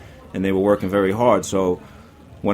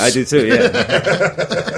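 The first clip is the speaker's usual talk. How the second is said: laughing; 10 semitones higher, 4 dB louder, much slower than usual. Real, not acted. Laughing at shared confession.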